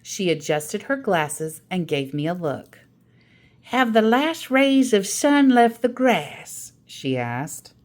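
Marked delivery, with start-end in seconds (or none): 3.6-6.7 higher, louder grandma telling a grand tale; a grandmother topping her sister's story; Grandma's storytelling voice. Acted. Grandma asks the child.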